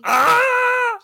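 high, loud shriek of pain; pained scream. Pretend. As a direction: scream as if struck. Scream after the punishment.

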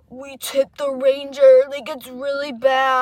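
loud, high, slow mock report; imitated alarmed friend. Friend on the phone reporting an accident, drawn-out mock alarm. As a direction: draw it out in mock distress. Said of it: "He's like".

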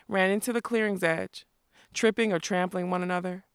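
ordinary delivery

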